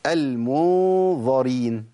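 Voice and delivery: المنظرين drawn out slowly with a full ظ; slow example; clear ظ. Shown as a demonstration. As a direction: hold the ظ in المنظرين. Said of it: "نجيب مثال تاني".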